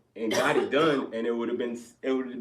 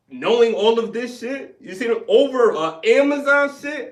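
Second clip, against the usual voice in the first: heated rant; 10 semitones higher, 10 dB louder than usual. Retold or imitated. Venting anger.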